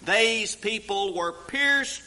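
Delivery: loud, high-pitched preaching about heart pain; full-force preaching. Acted. Preacher to congregation on being pierced through, fervent loud preaching. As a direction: preach it out with building fervor.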